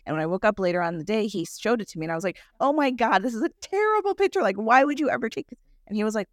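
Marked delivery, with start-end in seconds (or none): 2.6-5.6 suara tinggi ngeri, kaget melihat foto sendiri; mock-horrified, pitched well up; horrified exclaim. Horrified by a photo of herself.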